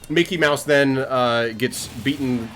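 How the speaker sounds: ordinary talk, nothing marked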